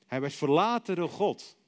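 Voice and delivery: ordinary talk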